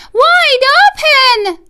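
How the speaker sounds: shrill it out like a frightened mouse; yelled. Acted. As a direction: mouse wife yelling at the fox, high squeaky shout. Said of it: "yelled his wife".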